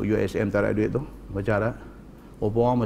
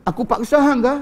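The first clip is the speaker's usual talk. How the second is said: high, loud, disbelieving question; incredulous questioning; 13 semitones higher, 5 dB louder than usual. Acted. Man asking whether he forced the woman, incredulous.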